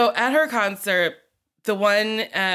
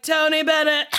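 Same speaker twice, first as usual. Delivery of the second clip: Gaga announcement; 7 semitones higher than usual. Retold or imitated. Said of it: "she was like".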